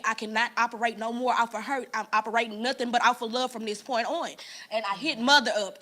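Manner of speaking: ordinary talk, nothing marked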